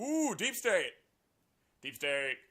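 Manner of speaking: loud high mock-spooky ooh; mock scary. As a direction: tease the phrase like a ghost story. Mocking the phrase.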